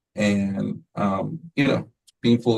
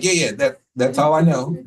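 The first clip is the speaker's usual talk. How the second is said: louder, embarrassed admission; sheepish laugh; 4 semitones higher, 4 dB louder than usual. Retold or imitated. Admits knowing no more French.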